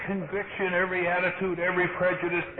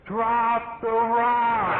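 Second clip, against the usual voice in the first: retold yell; 6 semitones higher, much slower than usual. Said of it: "they're yelling out there".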